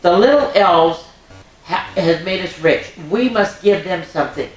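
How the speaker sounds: bright, lilting storybook narration; reading to children. A narrator's reading. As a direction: read it like a bedtime story for little ones.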